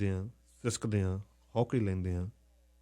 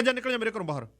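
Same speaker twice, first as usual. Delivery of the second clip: raised pitch, ordering her out; angry uncle ordering the niece out; 10 semitones higher, faster than usual. Retold or imitated. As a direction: throw her out of the house. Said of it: "ਮੈਂ ਕਿਹਾ".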